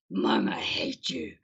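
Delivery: put-on slow whispered sulky teenager; whispered teen. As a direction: hiss it like a sulking teen.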